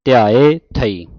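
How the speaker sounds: loud, slow ਧਿਆਏ, then ਥਈ set apart; emphatic teacher's ਧਿਆਏ ... ਥਈ; separated reading. Shown as a demonstration. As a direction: finish ਧਿਆਏ fully before saying ਥਈ. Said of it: "ਥਈ ਬਾਅਦ ਚ ਕਹਿਣਾ ਹੈ".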